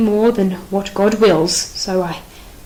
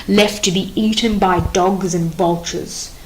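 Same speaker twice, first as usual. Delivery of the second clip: normal talking voice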